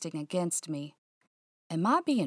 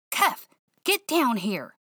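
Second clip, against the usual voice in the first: louder, slow, urgent call up the stairs; anxious summons; panicked call; 5 semitones higher, 4 dB louder than usual. Acted. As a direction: yell for the dog in fright.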